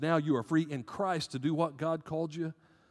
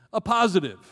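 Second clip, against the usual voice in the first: ordinary talk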